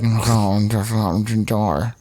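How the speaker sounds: low, slow garbled mumble; drunken slurring. Retold or imitated. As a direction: imitate a wasted man mumbling. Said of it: "he's super fucked up".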